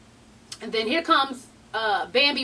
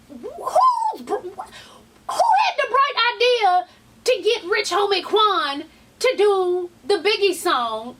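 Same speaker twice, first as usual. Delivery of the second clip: normal talking voice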